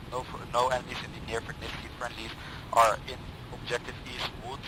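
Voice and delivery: ordinary delivery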